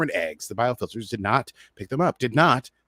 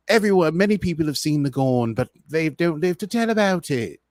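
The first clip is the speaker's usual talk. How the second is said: mock English accent, high and grand; La'an's accent impression; 5 semitones higher, 3 dB louder than usual. Recap host imitating La'an, mock English accent. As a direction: put on a clipped British lilt for La'an. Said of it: "that was my version of her accent".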